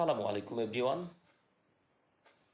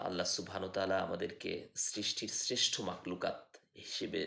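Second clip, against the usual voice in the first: normal talking voice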